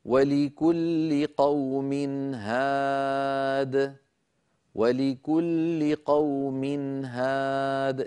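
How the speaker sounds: very slow, level chant of "ولكل قوم هاد", tanween not lengthened; clear izhar. Shown as a demonstration. Teacher reciting "ولكل قوم هاد" as tanween example, slow clear izhar. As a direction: stretch the long vowel of "هاد", pass straight from tanween to haa. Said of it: "لاحظوا بأنني لم أزد في زمن التنوين".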